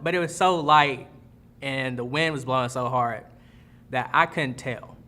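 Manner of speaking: ordinary talk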